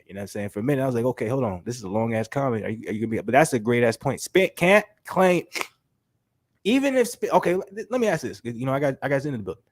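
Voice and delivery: usual speaking voice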